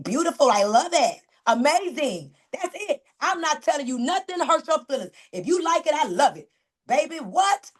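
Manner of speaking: normal talking voice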